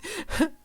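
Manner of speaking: high giggle; child's laugh. A narrator's reading. Boy laughing as a bubble pops, high giggle. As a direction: burst out laughing.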